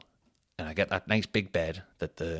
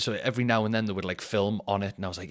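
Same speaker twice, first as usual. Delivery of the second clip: usual speaking voice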